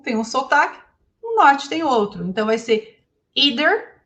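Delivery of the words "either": either said ee-der, with a rising tune; Southern American either, ee-ther. Shown as a demonstration. Teacher showing regional variants, Southern accent. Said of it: "no Sul".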